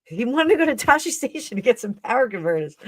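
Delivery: high whiny young-hero imitation; Luke Skywalker. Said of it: "Like Luke Skywalker".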